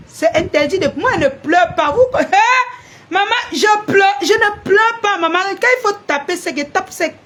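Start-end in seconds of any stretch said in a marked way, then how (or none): 1.9-2.2 mocking laugh, much higher. Real, not acted. 3.1-5.4 high, louder mock whimper to maman; mocking whiny child. Mocks someone crying to mom.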